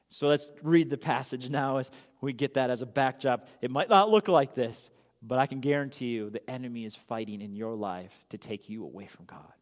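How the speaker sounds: ordinary talk, nothing marked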